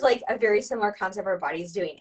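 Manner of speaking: ordinary delivery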